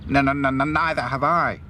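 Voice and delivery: Hi-Fi agreeing with Princess; Hi-Fi stammering. A narrator's reading. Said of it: "agreed Hi-Fi".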